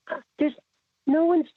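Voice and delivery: high flustered stammer. Retold or imitated. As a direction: caught off guard. Flustered reply to cop.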